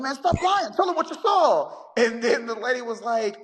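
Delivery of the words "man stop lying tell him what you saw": much higher, insistent man's voice pushing her; husband yelling in background. Retold or imitated. Husband behind the caller, pressing her on the 911 call, high insistent voice. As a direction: urge her hard to tell the truth, pitched way up.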